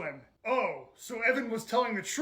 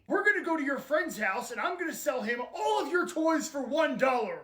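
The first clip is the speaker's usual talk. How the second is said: ordinary delivery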